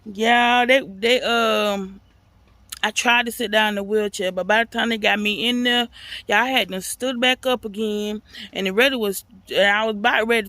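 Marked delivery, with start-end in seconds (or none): none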